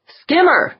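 loud high holler. A narrator's reading. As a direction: call it out across the bridge. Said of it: "Yeager hollered".

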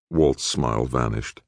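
ordinary delivery

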